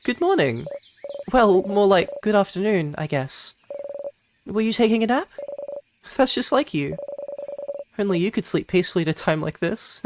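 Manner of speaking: put-on high, fast, light friendly girl voice; gentle teasing wake-up from a friend; cheerful friend. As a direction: tease a sleepy friend fondly. Voicing Mirabelle.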